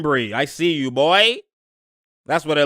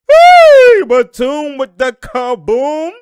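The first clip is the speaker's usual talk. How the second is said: much higher, much louder, slow hype call; hype shout; 10 semitones higher, 12 dB louder, much slower than usual. Real, not acted. Streamer hyping Batum's dunk, high slow shout. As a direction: yell it like the arena just exploded.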